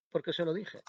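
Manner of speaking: mildly affronted vicar's wife; offended tone. A narrator's reading. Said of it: "Habló con un tono ofendido".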